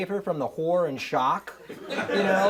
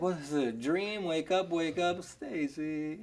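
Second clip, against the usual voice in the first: soft, lower-pitched half-asleep murmur; groggy dreaming; 3 semitones lower, 6 dB quieter than usual. Retold or imitated. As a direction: play it drowsy, as if still dreaming.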